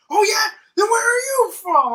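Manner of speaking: imitating a shrieked, challenging comeback; mock-outraged shout, pitched very high; confrontational customer. Customer turning on the hookah lounge worker, shouting a challenge.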